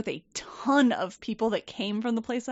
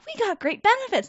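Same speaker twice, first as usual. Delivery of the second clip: much higher, sing-song parroting; sarcastic echo; 11 semitones higher than usual. Retold or imitated. Employees echoing the boss back, in sarcastic high parroting.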